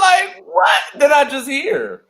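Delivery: high, loud, slow incredulous cry; what did I just hear, incredulous; disbelief. Real, not acted. Telling the cohost the colonel's insult, a loud incredulous cry. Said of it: "disbelief at the insult".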